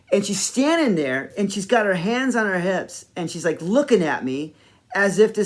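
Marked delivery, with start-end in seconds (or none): none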